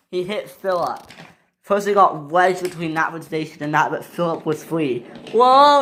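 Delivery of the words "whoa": loud, high whoa; high shouted exclamation; alarmed whoa. Acted. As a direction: holler it in alarm. Engine hurtling along.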